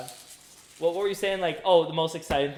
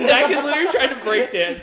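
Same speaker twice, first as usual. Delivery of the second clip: laughing; 7 semitones higher, 8 dB louder than usual. Real, not acted. Laughing at friend dancing.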